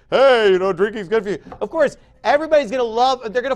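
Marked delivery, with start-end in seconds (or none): 0.1-1.4 loud, jolly mock salesman's pitch; mock idiot doctor. Preacher parodies a doctor pushing drink, mocking idiot doctor. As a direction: call it out as a silly doctor. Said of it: "some idiot doctor that's gonna tell you".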